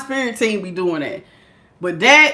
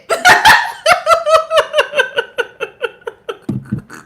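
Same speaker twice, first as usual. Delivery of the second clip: long high nervous cackle; shrieking laugh. Real, not acted. After the spider vanishes, a long high panicked laugh. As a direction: let the fear out as a high laugh.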